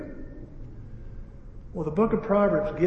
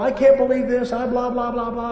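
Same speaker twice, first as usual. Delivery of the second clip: higher-pitched blah-blah griping; mock ranting; 6 semitones higher, 4 dB louder than usual. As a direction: imitate someone griping on and on. Mimicking customer's endless ranting.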